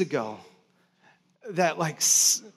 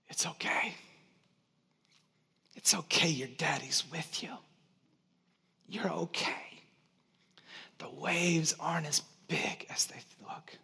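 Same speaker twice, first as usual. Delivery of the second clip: whispered comfort. Retold or imitated. Father whispering to his scared daughter on a paddleboard, gentle whisper. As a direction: whisper it close in her ear. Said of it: "I whispered in her ear".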